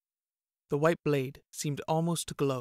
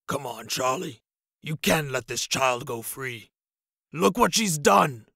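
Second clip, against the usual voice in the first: lamenting; 5 dB louder than usual. A narrator's reading. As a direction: protest like a sulking brute.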